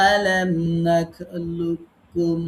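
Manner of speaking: "নাখলুককুম" with a doubled k and no separate q; slow merged recitation. Shown as a demonstration. Teacher reciting the corrected merged form slowly to the class. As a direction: draw out "আলাম নাখলুককুম" very slowly, merging into a doubled k. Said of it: "আমরা এখন পড়ব".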